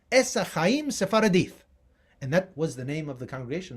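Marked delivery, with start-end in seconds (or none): 0.1-1.6 ES ACHAIM SEPHARADITH with plain S, silent H, final TH. Shown as a demonstration. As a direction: say ES for Etz, drop the H, end SEPHARADITH on TH. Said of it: "how the people used to say it".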